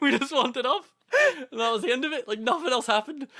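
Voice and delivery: real laughter carried into the words, high and loud; laughing. Real, not acted. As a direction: wrap up the story while cracking up. Cracking up as story ends.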